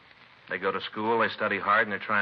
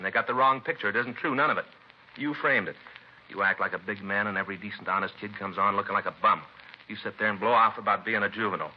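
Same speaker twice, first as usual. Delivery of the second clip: angry tirade. Acted. Detective lecturing the teen killer, rising angry contempt. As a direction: let the disgust boil over at him.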